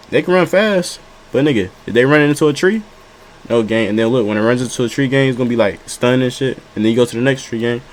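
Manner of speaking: ordinary talk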